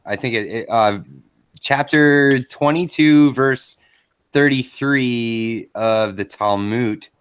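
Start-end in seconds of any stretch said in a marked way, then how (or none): 1.6-7.1 slow, drawn-out mock citation; mock scripture citation. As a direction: pretend to read out a verse, very slowly. Pretends to look up scripture.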